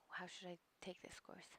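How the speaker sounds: breathy whisper, reading to herself; hushed aside while reading; whispered question. Real, not acted. Reading FAQ aloud.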